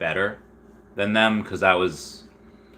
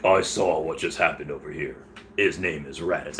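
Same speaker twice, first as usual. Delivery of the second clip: low, softer mock Aussie character voice; Australian accent; 6 semitones lower, 5 dB quieter than usual. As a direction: quote him low in a put-on Australian accent. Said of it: "gonna go back to English now".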